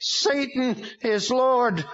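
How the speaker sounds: high, very slow demon voice; arguing demon. A narrator's reading. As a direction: insist like a demon. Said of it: "the demon argued".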